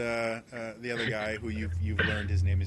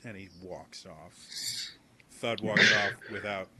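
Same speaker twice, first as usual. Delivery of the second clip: ordinary talk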